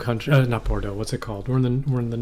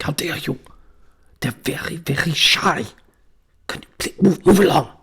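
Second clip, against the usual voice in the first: German birdwatcher; 2 semitones higher than usual. Retold or imitated. Birdwatcher shooing a walker away, indignant German voice. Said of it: "the guy had the same voice".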